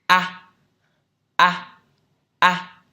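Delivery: exaggerated wide-mouthed A; teacher's exaggerated A, mouth wide open. Shown as a demonstration. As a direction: drop the jaw wide and say A loud.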